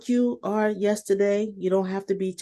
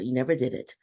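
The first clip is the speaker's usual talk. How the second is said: ordinary talk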